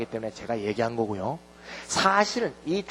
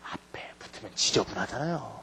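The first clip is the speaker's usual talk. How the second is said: whisper; 7 semitones lower, 13 dB quieter than usual. Real, not acted. Aside in class.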